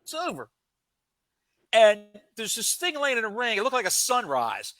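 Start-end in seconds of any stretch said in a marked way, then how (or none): 0.0-0.5 high, mocking crowd jeer; heckling fan. Fans in the crowd jeering at the wrestlers, high and mocking. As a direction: taunt like a rowdy fan.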